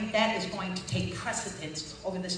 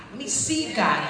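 louder preaching challenge; 3 semitones higher, 9 dB louder than usual. Real, not acted.

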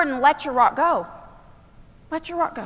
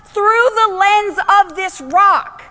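full-force preaching; 8 semitones higher, 8 dB louder than usual. Acted. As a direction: preach it out to the whole room.

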